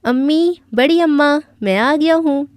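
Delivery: high, loud call across the house; calling out loudly. A narrator's reading. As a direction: shout it out to announce arrival. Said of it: "اونچی آواز میں چلانے لگا".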